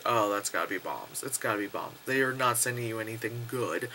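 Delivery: low, soft, sceptical aside; knowing suspicion. Retold or imitated. Viewer eyeing the dropped containers, quietly suspicious. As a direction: say it quietly as if seeing through the trick.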